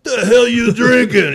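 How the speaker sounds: slow, high, loud New York holler; drawn-out mock New York shout; New York brogue. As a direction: imitate New Yorkers heckling their buddy. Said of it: "great New York brogue".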